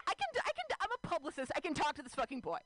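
squeaky high, rising pep talk to herself; frantic self pep talk. Retold or imitated. Nervous woman hyping herself up before approaching the boy, high and frantic. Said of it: "I was like".

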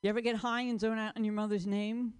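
ordinary talk, nothing marked

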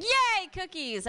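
high, slow cheery yay; imitating a childish cheer; mock excitement. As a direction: squeal it up delightedly. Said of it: "and I was like".

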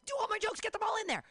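hurry-up voice of a nervous comic; rushed self. Retold or imitated. Comic mocking her rushed self, frantic and loud. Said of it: "because I was like".

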